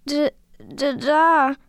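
broken stutter ending in a cry; frightened stammer. A narrator's reading. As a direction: stammer in fright while pointing. Stammers on seeing frightening creature.

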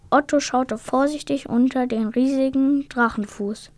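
usual speaking voice

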